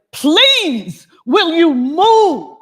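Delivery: high, loud, slow demand: "Please, will you move?"; exasperated yell at a waitress who won't budge; angry shout. Retold or imitated. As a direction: lose patience and bellow it out. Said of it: "he gets so upset".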